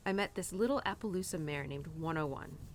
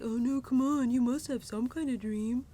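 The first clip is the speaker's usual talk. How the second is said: louder, higher mock coaxing; lightly voiced pushy boyfriend, urging; imitating her boyfriend; 5 semitones higher, 6 dB louder than usual. Boyfriend pressing her to name a dream, mock louder coaxing. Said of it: "And he was like".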